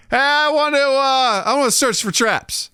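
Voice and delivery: high-pitched mock player voice; imitated eager player.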